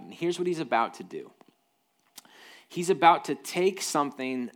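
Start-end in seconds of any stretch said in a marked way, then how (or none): none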